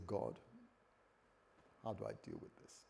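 soft, low, private plea; quiet prayer. Acted. David praying to God for help, quietly. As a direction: ask God humbly, voice dropped right down. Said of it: "began to pray".

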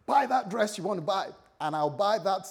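loud, high, generous sales pitch to his wife; hammed-up excited offer; excited husband. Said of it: "He's excited".

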